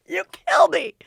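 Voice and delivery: high, laughing, hitting kill; imitating Matt laughing at a joke that isn't funny; mock laughing. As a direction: cackle it like it's the funniest thing ever. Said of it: "he laughs really hard at things".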